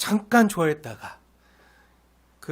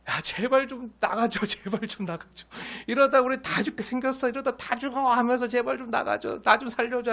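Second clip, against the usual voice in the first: higher, pleading for them to leave; desperate begging; 6 semitones higher than usual. Retold or imitated. Pharaoh begging Israel to leave, a higher, desperate plea. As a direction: beg like a ruler in pain. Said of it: "하면서 간청하면서".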